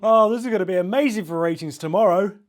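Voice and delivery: loud, high, fast delight; host thrilled by the creepy call for ratings; gleeful host. Acted. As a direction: laugh and gush about ratings.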